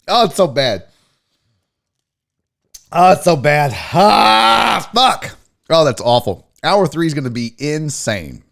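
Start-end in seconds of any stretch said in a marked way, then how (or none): 3.7-4.9 loud, open "ah" of disgust; loud exhale after a shot. Real, not acted. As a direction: let out a big burning-drink gasp. Wincing after a bad drink.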